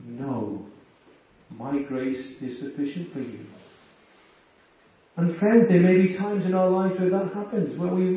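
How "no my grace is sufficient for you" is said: quiet divine answer. Retold or imitated.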